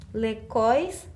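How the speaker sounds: "lecóis" with a hard k sound; missing cedilla. Shown as a demonstration. Teacher reads what is written, "lecóis" with k for the c. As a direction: say "lecóis" as le-KÓIS. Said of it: "O que que falta na minha palavra".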